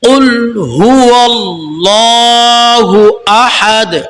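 কুল with a bouncing ক্বাফ, slow chant; tajweed recitation. Shown as a demonstration. Teacher repeats the correct recitation, slow chant. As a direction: recite slowly, qalqala on কুল. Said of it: "আবার বলছি, কফ কলকলা হবে".

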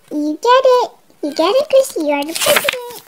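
ordinary talk

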